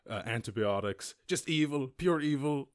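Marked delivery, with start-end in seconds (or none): 1.3-2.7 mock outrage. Mocking the anti-capitalist take.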